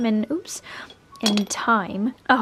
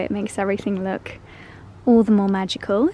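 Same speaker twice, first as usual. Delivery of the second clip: normal talking voice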